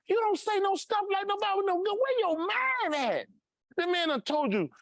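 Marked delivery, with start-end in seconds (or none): 0.1-3.3 incredulous. Real, not acted. Incredulous rant about the gun.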